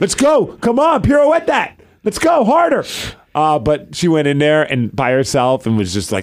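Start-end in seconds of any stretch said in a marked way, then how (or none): 0.0-2.8 mock sports cheering. Dad jokingly cheers daughter's pirouette, hockey-fan style. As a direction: hype up the ballet like a sports fan. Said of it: "cheering at ballet class".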